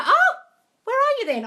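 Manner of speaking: high, loud eager question; excited squeal. Real, not acted. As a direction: squeal with curiosity.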